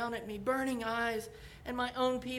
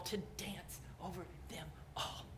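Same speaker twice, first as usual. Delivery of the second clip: much softer, unvoiced whisper; fading whisper; 3 semitones lower, 10 dB quieter than usual. God ending his promise in a whisper. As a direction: let it fall to a whisper. Closing phrase hushed.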